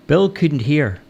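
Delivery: ordinary delivery, nothing marked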